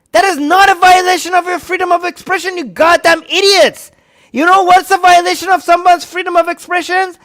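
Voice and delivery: very high, loud yelling; furious screaming. Real, not acted. Raging at the critics, screaming at the top of his voice. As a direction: scream it at the morons.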